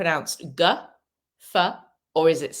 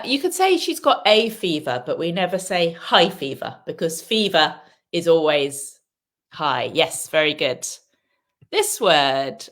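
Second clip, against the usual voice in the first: normal talking voice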